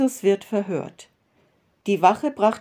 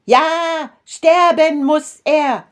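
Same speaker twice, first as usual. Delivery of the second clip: high, loud, slow shout of the crowd; crowd screaming; 9 semitones higher, 9 dB louder, much slower than usual. A narrator's reading. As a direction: scream out the death demand, drawn out. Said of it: "schrien alle".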